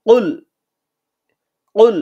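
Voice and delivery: কুল said with the tongue root against the palate; qaf sound. Shown as a demonstration. Teacher drilling the heavy qaf, কুল repeated. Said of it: "জিহ্বার গোড়া তার বরাবর উপরে তালুর সঙ্গে লাগাইয়া".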